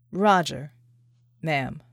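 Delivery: a soldier joking that he's still alive; John's voice, teasing. A narrator's reading. John teasing Vicky.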